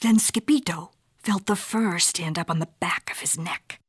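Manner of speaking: plain reading voice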